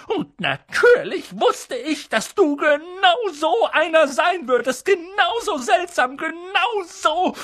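high, scornful scolding; Tante Petunia ranting. A narrator's reading. Aunt scolding Harry about his parents, high angry rant. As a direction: pour out years of resentment at high pitch. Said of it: "fing dann erneut an zu schimpfen".

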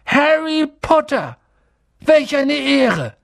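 high, loud, drawn-out cry of wonder; awestruck. Acted. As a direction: exclaim it as an overjoyed old barman. Barman awestruck at meeting Harry.

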